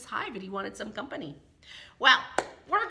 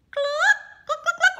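very high, loud hen clucks; hen clucking; 10 dB louder than usual. Acted. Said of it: "what the hen says".

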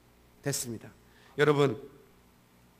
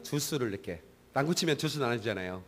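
ordinary delivery

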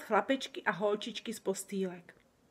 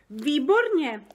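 higher and louder delighted cry; exclaiming; 7 semitones higher, 8 dB louder, slower than usual. A narrator's reading. Sofie exclaiming with delight at the giant's answer, higher and louder. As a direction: burst out with delight. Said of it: "zvolala Sofie".

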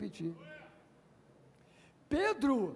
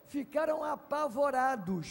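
usual speaking voice

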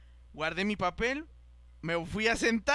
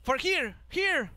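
high, punched English command; imitated officer's order; 7 semitones higher, 3 dB louder, much slower than usual. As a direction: call out directions like an officer. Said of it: "que nos dicen". (imitando una orden en inglés)

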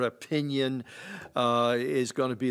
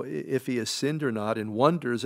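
ordinary talk, nothing marked

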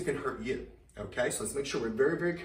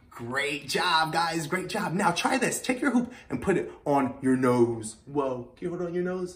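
ordinary talk, nothing marked

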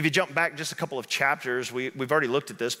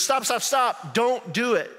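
high-pitched mock alarm; urgent plea; 8 semitones higher, slower than usual. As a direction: act out stopping someone, urgent and high. Joke man stops a jumper.

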